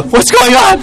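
very high shocked squeak; startled yelp. Retold or imitated. Cowherd boys ambushed by gopis, very high startled cry. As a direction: jump out of your skin.

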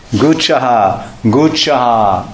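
"Guchaha" said very slowly, syllable by syllable, goo-cha-ha; careful slow spelling-out. Shown as a demonstration. Teacher sounding out "Guchaha" for the audience, very slowly. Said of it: "repeats the word slowly".

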